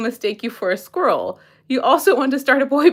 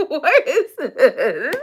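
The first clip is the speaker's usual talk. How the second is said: laughing; 7 semitones higher, much slower than usual. Real, not acted. Laughing at quiz options.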